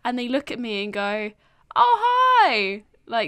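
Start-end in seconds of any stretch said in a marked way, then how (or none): 1.7-2.8 high, loud squealed hi; mock delighted welcome; gushing hello. Someone greeting her in a room, squealing hi.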